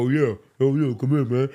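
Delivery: celebrity impression, far below the fan's yelps; imitating Shaq. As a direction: answer like an unbothered big man. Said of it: "have the same exact voice".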